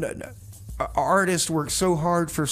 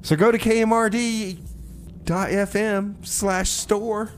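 plug; 9 semitones higher, 4 dB louder, slower than usual. Acted. Radio plug for station store.

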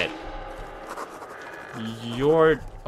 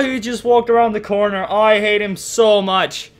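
ordinary talk, nothing marked

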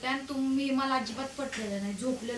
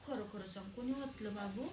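soft, lower voice coaxing the truth; gentle coaxing; 5 semitones lower, 12 dB quieter than usual. Real, not acted. Mother softly asking her child who poured the water. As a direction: coax the little one kindly.

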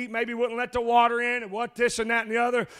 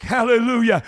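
loud, driving sermon cadence with uh breaths; preaching at full force; 2 semitones higher, 6 dB louder than usual. Acted. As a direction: preach it hard to the congregation, louder and louder.

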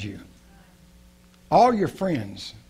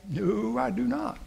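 pitched-up honest no; answering his own question with a high, soft no; high admission; 8 semitones higher, 5 dB quieter than usual. Real, not acted. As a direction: admit it lightly, voice rising. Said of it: "self-answered question".

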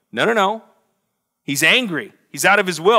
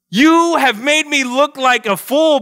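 slow, higher and a little louder mock shout; 9 semitones higher, 4 dB louder, slower than usual. Balaam rebuking his donkey in an acted shout. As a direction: scold the donkey with wounded pride. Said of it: "Balaam shouted".

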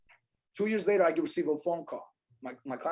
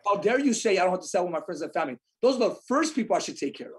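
indignant; 4 dB louder than usual. Real, not acted. Agent objecting to companies' advice on family, indignant. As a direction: push back as if personally insulted.

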